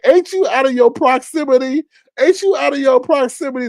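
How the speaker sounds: high-pitched incredulous questioning; mock challenge. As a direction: quiz him in comic disbelief. Retold challenge to monitored man.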